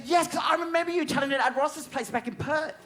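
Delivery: imitating usher. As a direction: ask it with starstruck eagerness. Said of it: "she goes".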